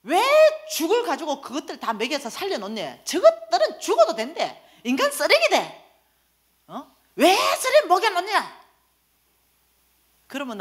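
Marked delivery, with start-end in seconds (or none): 0.0-8.7 high-pitched mock yell of a tape seller; hammed-up scolding shout; angry yelling. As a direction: berate her at the top of your voice. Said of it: "나보고 고함을 지르는 거예요".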